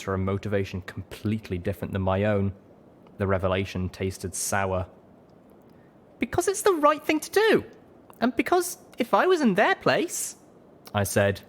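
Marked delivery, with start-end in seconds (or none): 6.2-10.4 high-pitched, emphatic character voice; earnest young heroine. A narrator's reading. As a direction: insist on your principles, pitched high.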